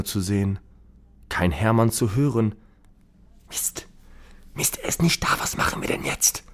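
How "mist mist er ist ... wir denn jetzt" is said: urgent whisper. Acted. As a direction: whisper in a panic. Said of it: "flüstert Selina zu Anna". (nervös flüstern)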